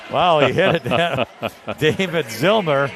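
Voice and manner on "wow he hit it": laughing. Real, not acted. Laughing at the late shot.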